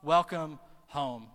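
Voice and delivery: loud, slow, heartfelt welcome; emphatic welcome. Acted. Father welcoming his lost son home, emphatic welcome. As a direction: declare it loudly, letting each phrase land.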